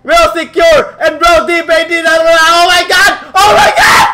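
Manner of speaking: real live shout, drawn-out Oh my Gods; screaming play call. Real, not acted. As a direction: lose it on the winning teamfight. Caster screams at game-winning play.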